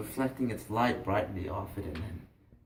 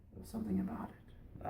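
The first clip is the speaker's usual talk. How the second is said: soft, airy, near-whispered wonder; awed boy; 7 semitones higher, 10 dB quieter than usual. Acted. Boy confiding fascination to fisherman, hushed awe. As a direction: let it fade into a hush.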